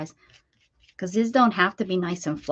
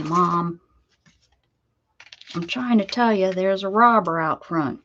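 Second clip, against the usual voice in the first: dog's moan; 3 dB louder than usual. Retold or imitated. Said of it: "hear him moan like".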